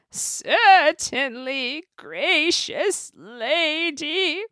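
high, very slow honeyed villain; wicked fairy. A narrator's reading. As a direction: drag it out with false sweetness. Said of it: "said the wicked fairy".

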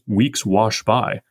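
"weeks wash by" drawn out slowly; slow quoted phrase. Shown as a demonstration. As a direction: linger on each word. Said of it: "The phrase".

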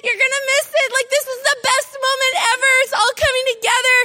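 high, fast, thrilled calling out; excited screaming. Retold or imitated. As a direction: shriek to Brian that it's finally done. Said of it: "I'm screaming in the dining room".

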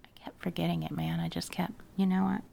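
hushed, breathy whisper. Real, not acted. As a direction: whisper it like a secret. Sudden whispered chat about passwords.

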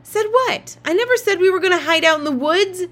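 high, loud indignant yell. A narrator's reading. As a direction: yell back, denying it hotly. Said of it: "Now Claudia was yelling too".

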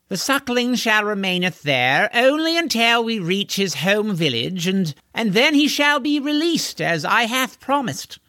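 high, haughty archaic lordling; pompous horseman. Acted. Horseman grandly promising the boy's release, pompous.

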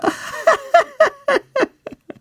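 shrill high wild devil laugh; devilish cackle. A narrator's reading. Devil laughs at trapped Childeret, shrill cackle. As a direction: cackle in triumph.

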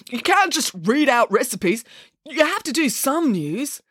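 high, slow, pleading objection; exasperated television boss; news director, protesting. Acted. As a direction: insist wearily, stretching the words.

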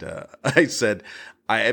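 usual speaking voice

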